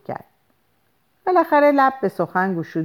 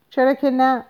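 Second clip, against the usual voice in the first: high-pitched, dismissive retort; wanting to hang up; 9 semitones higher, 3 dB louder than usual. A narrator's reading. Woman means to put down the receiver, dismissive. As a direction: toss it off to end the call. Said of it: "با لحنی که به وضوح به من فهموند که قصد گذاشتن گوشی".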